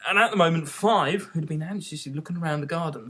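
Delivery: usual speaking voice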